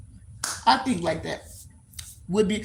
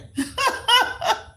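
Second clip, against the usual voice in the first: high laugh. Real, not acted. Laughs after hot as hell.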